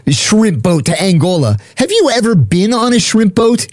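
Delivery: scoffing at a tall tale; incredulous mockery. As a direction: scoff at his claim, pitch climbing on the question. Mocking Truck's tall tale.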